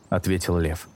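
usual speaking voice

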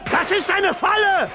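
high, loud battle yell; warning shout. Acted. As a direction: yell a warning at the major across the fight.

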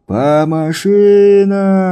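long, high, loud call; drawn-out shouted command. Acted. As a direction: bellow the order, stretched out. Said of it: "протяжная команда".

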